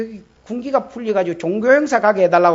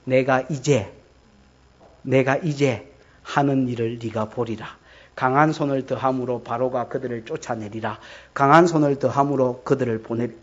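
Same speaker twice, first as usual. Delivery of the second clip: much lower, softer, solemn; voice of God; 9 semitones lower, 6 dB quieter than usual. Acted. 하나님이 모세에게 약속하며, 낮고 장엄하게. God's voice quoted.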